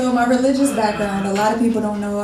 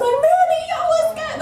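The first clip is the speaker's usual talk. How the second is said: high-pitched excited call to a buddy; excited greeting. Retold or imitated. Greeting a good friend in public, acted excitement. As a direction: act out hailing a close friend, pitched way up.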